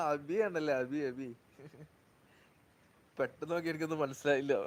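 ordinary delivery

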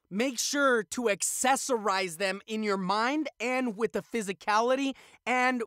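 usual speaking voice

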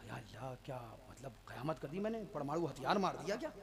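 low, hushed alarm; frightened. Acted. Scolded newcomer wonders what he did, scared. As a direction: shrink back, murmuring in acted fright. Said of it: "तो डर गया".

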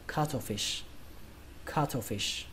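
cuttlefish said as KA-tho-fish; "cuttlefish" with a puffed T in the middle, not a D; aspirated T. Shown as a demonstration. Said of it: "mình quen là cattle hơn".

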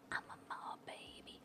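voiceless, very soft whisper. Acted. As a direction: breathe it like a secret. Whispered odd line.

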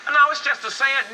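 loud, high imitated objection; mock protest. Grandson excuses his saying to grandfather, mock protest.

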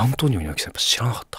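hushed whisper. Real, not acted. Whispering to his co-host about the young girl. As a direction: drop to a whisper, like sharing gossip.

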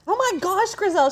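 high, amazed outburst; astonished exclamation. Real, not acted. Reacting to a viewer's comment.

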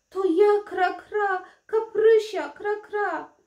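desperate calling. Acted. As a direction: cry out to her father to know her. Princess pleads with her father.

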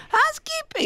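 very high, falling sing-song call; falsetto housekeeping call. Retold or imitated. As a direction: squeak it out like a silly maid. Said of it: "And I go".

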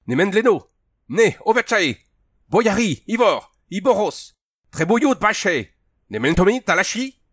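high, loud foreign demands; imperious high-pitched shouting; shouted challenge. A narrator's reading. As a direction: bark out demands at an enemy.

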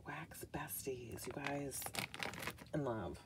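soft unvoiced whisper, slowing down. Real, not acted. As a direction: breathe it out as a secret to viewers. Whispered apology to viewers.

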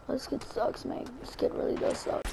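whisper. Real, not acted. Mocking opponent under breath.

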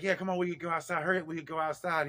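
fast, high, loud urging; retold hurrying. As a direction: hustle a little kid along in a rush. Hurrying a child outside.

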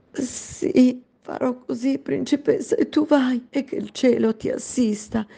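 high, tender servant's voice; tearful maid. Acted. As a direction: send her off with a blessing. Maid releases princess.

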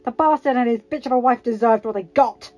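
loud, heated denial; spat out. A narrator's reading. Karpov denying blame for the Starks' deaths, spitting out. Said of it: "Karpov spat out".

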